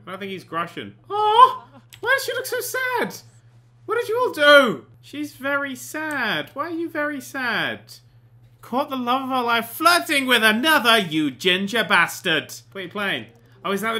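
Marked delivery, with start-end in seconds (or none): 1.1-8.0 very high-pitched, wailing fuss; mock concern. Player fussing over a sad Sim, exaggerated dismay. 8.6-12.5 loud, indignant accusation; mock outrage. Player confronting a flirting ginger Sim, loud outrage. Berates cheating Sim for the betrayal.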